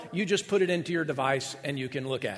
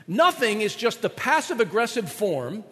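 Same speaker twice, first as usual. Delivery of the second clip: ordinary talk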